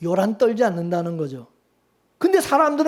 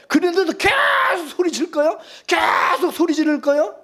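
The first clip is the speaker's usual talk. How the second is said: higher, raised challenging call; preaching shout; 7 semitones higher than usual. Real, not acted. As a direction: press the question hard to the congregation. Preacher challenging noisy prayer.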